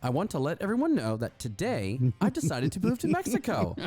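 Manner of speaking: high, sing-song mock read; mock announcement as her. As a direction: read it out high, with swooping pitch. Reads fake post as Jen.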